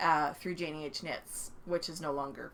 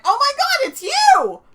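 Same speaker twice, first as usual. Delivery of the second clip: very high, loud starstruck shriek; fangirl squeal; 16 dB louder, slower than usual. Retold or imitated. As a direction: squeal at meeting your idol. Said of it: "And Sue went".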